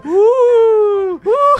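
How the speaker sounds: very high, loud ghost howl; ghostly wooing. Pretend. Prankster flying the toy around, high loud spooky wooing. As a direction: wail like a pretend ghost on the loose.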